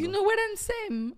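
teasing friend imitates his English accent; mocking gringo accent. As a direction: parody his gringo phrase back at him. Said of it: "No te burles de mi acento".

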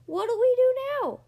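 high, loud pirate shout; loud, high alarmed question; panicked yell. A narrator's reading. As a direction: yell for help over the storm. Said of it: "yelled one of the pirates".

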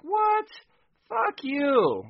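very high shouted outburst; squeaky high indignant shout; outraged yell. Retold or imitated. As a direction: blow up at her, shocked. Cops outraged at morgue lady.

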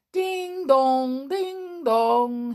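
high, ringing ding dong like a chiming clock; clock chime. Acted. Clockwork mouse chiming the hour for his friends. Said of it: "suddenly chimed".

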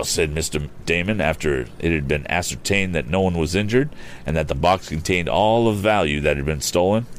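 ordinary delivery

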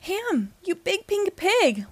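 high, slow exclamation; drawn-out high-pitched surprise; astonished greeting. A narrator's reading. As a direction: exclaim it in fond disbelief. Woody greets the found friend.

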